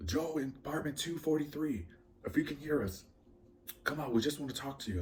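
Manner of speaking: high, carrying megaphone call, imitated; police PA announcement. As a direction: mimic a cop calling over a loudspeaker. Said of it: "announcing on the P A".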